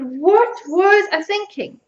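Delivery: slow "what was I thinking", lifted in pitch; incredulous self-reproach. Real, not acted. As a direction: stretch "what" and "was" in disbelief. Repeats despair at her old project.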